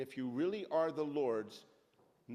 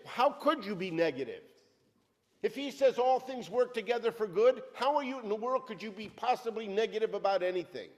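raised, driving preacher's voice; fiery preaching; 2 semitones higher, 4 dB louder than usual. Acted. Preacher exhorting the church, full-force preaching. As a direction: preach it hard to the congregation.

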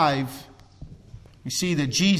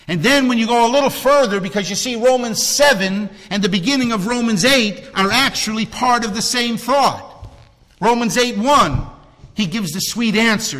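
higher, faster, louder sermon drive; urgent preaching; 6 semitones higher, 5 dB louder than usual. Acted. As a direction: rush ahead, fired up.

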